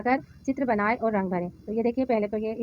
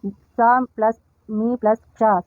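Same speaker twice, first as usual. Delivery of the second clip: very slow, segmented spelling; deliberate teacher's spelling-out; sound by sound; 3 dB louder, much slower than usual. Shown as a demonstration. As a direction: hold each piece of समीक्षा apart. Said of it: "अक्षरों, वर्णों को मिलाकर पढ़िए".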